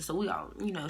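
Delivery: normal talking voice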